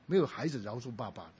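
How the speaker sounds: ordinary delivery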